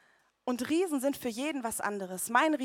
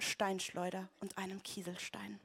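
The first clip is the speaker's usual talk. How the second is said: whispered; 2 semitones lower, 8 dB quieter than usual. Acted. Dropping voice at the climax.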